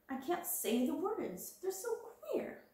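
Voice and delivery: put-on a girl baffled by strange words; whiny schoolgirl. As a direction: let it climb up high and fade in puzzlement. Ermengarde complains words are queer.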